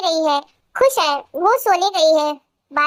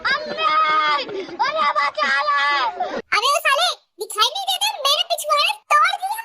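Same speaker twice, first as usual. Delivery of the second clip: higher, rough mock scolding; rough street scolding; 6 semitones higher than usual. A street man scolds the girl who ran into him, mock scolding. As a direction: tell off the girl who hit you.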